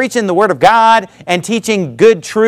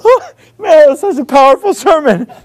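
awed churchgoer; 8 semitones higher than usual. Retold or imitated. Churchgoer leaving the service, mock awed gushing. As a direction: gush like a moved churchgoer. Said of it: "you walk out of church and you're like".

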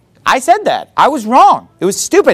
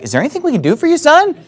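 eager parents; 6 semitones higher than usual. Retold or imitated. Parents eagerly offering help.

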